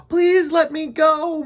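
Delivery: high, loud, slow pleading squeak. A narrator's reading. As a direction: squeak out a desperate plea. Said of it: "Mister Bumble squeaked".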